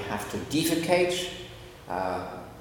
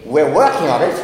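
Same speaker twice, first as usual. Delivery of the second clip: joking students; 9 dB louder than usual. Retold or imitated. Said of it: "some of my American students say to me".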